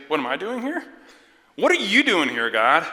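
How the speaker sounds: loud, high, exasperated questioning; indignant challenge. Acted. Believer turning God's question on God, indignant shout. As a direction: throw the question back, outraged.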